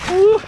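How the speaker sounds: high, loud cheer; excited whoop. Real, not acted.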